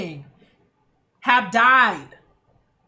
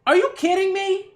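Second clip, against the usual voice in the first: disbelief; 7 semitones higher, 4 dB louder than usual. Retold or imitated. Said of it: "I'm like".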